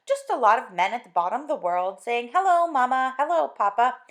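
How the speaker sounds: loud sing-song mock greetings; mocking dismissal. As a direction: make fun of the broadcast. Wife mocking radio greetings.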